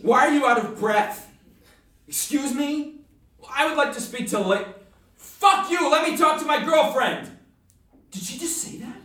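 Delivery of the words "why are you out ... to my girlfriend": angry, louder yelling into the phone; furious at the guy on the phone; phone fight. Acted. Man yelling at his girlfriend's lover on the phone, angry.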